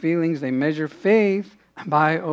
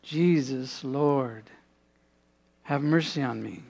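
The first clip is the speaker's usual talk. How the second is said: quiet prayer; 4 semitones lower, 7 dB quieter than usual. Retold or imitated. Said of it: "I've prayed that so many times".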